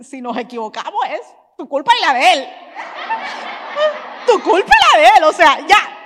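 loud, high laughing blame; laughing joke. Acted. Pastor's wife joking to the congregation, loud laughing blame.

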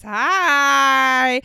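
loud, high, stretched-out arrival greeting; hammed-up surprise entrance; Jill Zarin. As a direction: announce yourself in a big showy impression. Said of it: "That's my Jill Zan voice".

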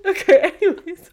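cracking up at yourself; laughing. Real, not acted. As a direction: say it through real laughter. Laughing at own accent.

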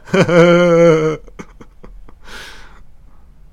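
high, loud maniacal cackle; maniacal killer laugh. Pretend. The movie killer gloating over his victim, in a loud maniacal cackle. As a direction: cackle like a deranged slasher.

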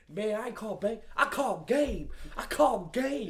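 cocky, rising and laughing; imitating Paul Pierce. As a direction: brag like a star after a winner. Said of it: "He's like".

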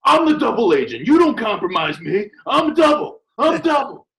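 high loud hammed-up yelling; over-the-top reality-show rant; mock angry outburst. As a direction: shout it like an unhinged double agent.

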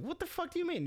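mock-outraged squeal of disbelief; indignant disbelief. Retold reaction to nose insult.

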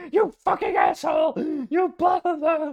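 very high, loud screaming rage; furious scream. Retold or imitated. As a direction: blow up at him completely. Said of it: "he just, like, flipped".

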